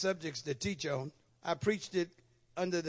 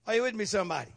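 ordinary talk, nothing marked

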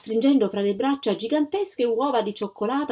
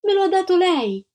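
high, raised-voice protest; wronged little girl crying out; indignant child's cry; 10 semitones higher, 4 dB louder than usual. A narrator's reading. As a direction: throw the accusation back, high and insistent. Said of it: "gridò Antonella".

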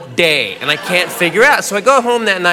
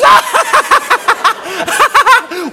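high, helpless cackling; hammed-up uncontrollable laughter; imitated girlfriend laughing fit; 12 semitones higher, 4 dB louder than usual. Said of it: "And she goes".

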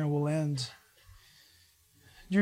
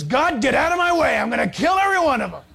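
louder, fast furious shout with a laugh; angry Moses; 6 semitones higher, 6 dB louder than usual. Acted. Moses furious at the complaining people, hammed-up angry shout. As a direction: blow up, half laughing, at God. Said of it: "Moses says".

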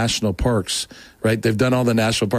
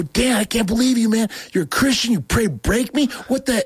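so mad; 12 semitones higher than usual. Retold or imitated. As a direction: replay the indignant outburst at Dan, pitched way up. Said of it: "so mad".

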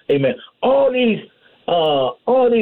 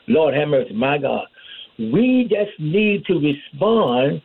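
usual speaking voice

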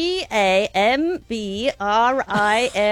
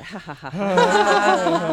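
chuckle on the out breath; much slower than usual. Shown as a demonstration. Teacher leading the group breathing out with a laugh. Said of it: "do a little chuckle on the out breath".

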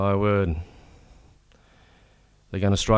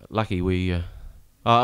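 ordinary talk

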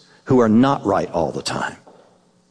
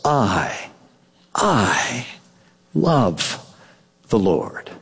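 slow, broken "I, I love the Lord", lower and hushed; halting and tender; 6 semitones lower, much slower than usual. Shown as a demonstration. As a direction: let each word hang, tender and hesitant. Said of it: "The psalmist just says".

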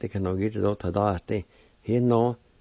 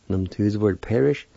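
ordinary delivery

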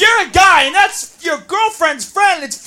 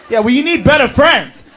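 bossy friend sneering; 6 semitones lower than usual. Acted.

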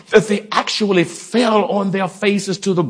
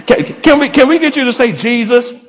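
urging the crowd; 5 semitones higher, 3 dB louder, faster than usual. Real, not acted. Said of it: "we can't even get y'all to sing".

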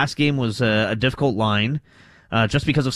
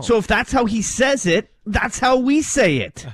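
pitched much higher, worked up and insistent; riled up; 10 semitones higher than usual. Real, not acted. Host arguing pronunciation with his cohost, riled up and high-pitched. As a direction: get genuinely riled over the point. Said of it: "I like it when you get riled up".

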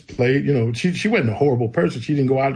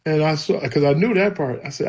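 normal talking voice

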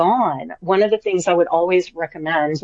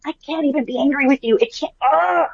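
high, quick, helpless adoration; doting gush; 7 semitones higher than usual. Retold or imitated. Owner to her teddy-bear dog, helplessly doting. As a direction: gush at a pet too cute to scold.

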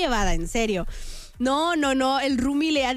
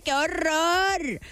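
very drawn-out, higher-pitched dismay; mock horror; 4 semitones higher, much slower than usual. Host reacting to family defending roomie, dragged-out mock horror. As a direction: stretch it out in theatrical horror.

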